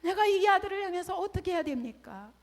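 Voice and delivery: high, urgent startled plea. Retold or imitated. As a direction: blurt it out in shock to God. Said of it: "깜짝 놀라서". (놀라서 하나님께 호소하듯)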